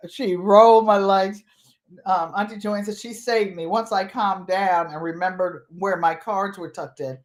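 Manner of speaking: normal talking voice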